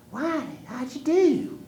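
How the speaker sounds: put-on high, loud old woman; grandma voice. Grandma greeting Wiley on the porch, high old woman voice. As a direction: ask like a caring old grandma.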